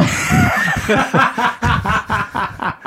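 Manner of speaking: a real laugh, high and long. Real, not acted. Laughing at smelly fingers.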